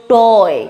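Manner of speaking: টয় with an অ vowel, said firmly; English word pronunciation. Shown as a demonstration. Teacher modelling toy for students, clear and emphatic. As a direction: lean on the অ in টয়. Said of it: "অ এর মতো হচ্ছে".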